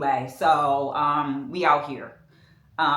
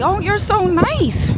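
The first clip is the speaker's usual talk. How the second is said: high sweet quote; 12 semitones higher, 3 dB louder, slower than usual. Retold or imitated. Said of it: "everybody's like".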